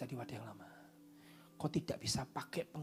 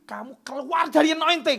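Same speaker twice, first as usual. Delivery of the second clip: high, loud preaching cry; fiery preacher shout; 11 semitones higher, 13 dB louder than usual. Real, not acted. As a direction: thunder the warning. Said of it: "preaching at full force".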